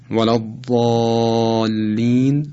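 ولا الضالین with the alef after ض held very long; recitation-style ولا الضالین, long sustained dark "aa"; extended madd. Shown as a demonstration. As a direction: slowly stretch the "ḍaa" in ولا الضالین. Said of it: "بیش از این حالت طبیعی صدا در اونها امتداد".

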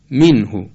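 منهو said "minhu", close "i"; firm Arabic "minhu" for منهو; exact Arabic vowels. Shown as a demonstration. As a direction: teach منهو with short i and u. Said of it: "تلفظ دقیق عربی این کلمه".

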